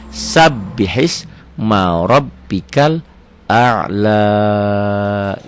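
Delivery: very slow chant, long final a'la; slow Quran recitation. Shown as a demonstration. Teacher recites the full verse slowly. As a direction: draw out a'la at the end. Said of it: "B-nya berasa".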